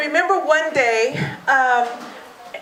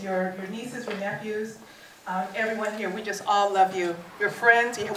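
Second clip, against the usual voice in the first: tender; 6 dB quieter than usual. Real, not acted. Heartfelt address to ninety-year-old mother.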